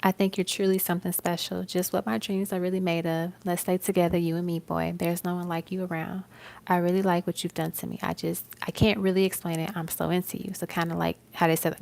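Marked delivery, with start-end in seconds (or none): 0.0-10.5 lyric recitation. Acted.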